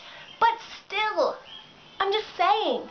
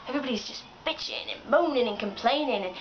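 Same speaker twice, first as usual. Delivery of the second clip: normal talking voice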